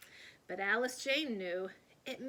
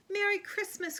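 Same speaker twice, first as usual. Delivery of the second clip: high, louder festive call; cheerful cry; 10 semitones higher, 5 dB louder than usual. A narrator's reading. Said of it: "cried the delivery man".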